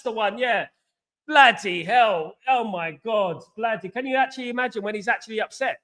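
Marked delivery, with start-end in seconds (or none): none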